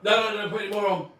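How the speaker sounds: urgent shouted begging; loud pleading. Real, not acted. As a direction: stop him, loud and fast. Begging Tom not to stuff mouth.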